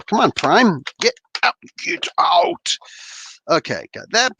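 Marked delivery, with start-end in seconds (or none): none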